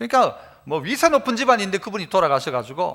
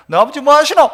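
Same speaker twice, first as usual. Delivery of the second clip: louder, higher mock interrogation; retold haughty challenge; 5 semitones higher, 7 dB louder than usual. Driver before the father appears, mock haughty challenge. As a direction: act out the driver pressing the child. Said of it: "이랬던 그 사람이".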